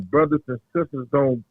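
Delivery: ordinary talk, nothing marked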